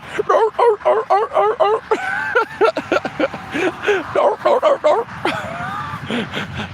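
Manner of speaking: high honking seal imitation; seal noise. Doing the audience seal for a viewer, high honks. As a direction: honk like a clapping seal. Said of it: "How was that seal again?".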